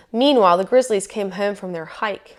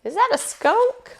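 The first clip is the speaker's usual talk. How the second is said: very high little cub squeak; squeaky bear cub; 13 semitones higher than usual. A narrator's reading. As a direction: squeak the question as a small cub. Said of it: "squeaked the bear cub".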